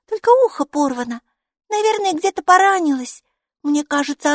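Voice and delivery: put-on high, bright child's voice, chattering; a cheerful little girl greeting her strange friend; little girl Maiki. Майки, маленькая девочка, радостно болтает с Букой.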